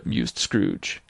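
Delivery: ordinary delivery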